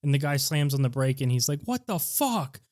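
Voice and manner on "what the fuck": much higher, startled yelp; shocked outburst. Retold or imitated. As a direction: react as if a bullet just flew past. The driver is shocked by the gunshot.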